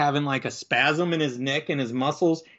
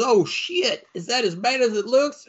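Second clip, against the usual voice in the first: high, louder, shocked outburst; shocked deputy; 8 semitones higher, 3 dB louder than usual. Retold or imitated. Deputy seeing the dead man, high shocked outburst. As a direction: recoil at the sight of the body.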